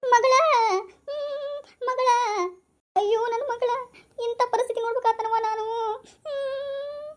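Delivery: high keening grief over her daughter; wailing mother. Acted. Mother wailing over her daughter's body. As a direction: cry out for your dead child. Parent mourns dead daughter.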